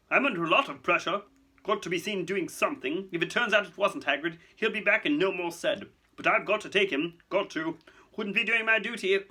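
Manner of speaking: high, pressured bureaucrat voice; harried official. Acted. Fudge excusing Hagrid's arrest to Dumbledore, harried. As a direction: justify yourself in flustered bursts.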